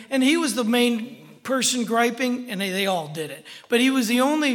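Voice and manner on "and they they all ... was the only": ordinary delivery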